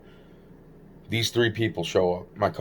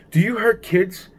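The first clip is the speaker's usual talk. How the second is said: much higher, put-on voice for the friend; Alfredo's odd question; 12 semitones higher than usual. Retelling Alfredo asking the narrator, high put-on voice. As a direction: ask it innocently, voice pitched way up.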